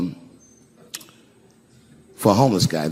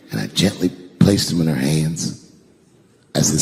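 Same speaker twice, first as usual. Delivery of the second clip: normal talking voice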